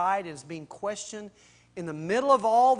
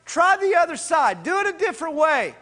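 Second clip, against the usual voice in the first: heckling; 8 semitones higher, 10 dB louder than usual. Retold or imitated. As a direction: heckle the fishermen from the shore. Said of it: "like, heckling them".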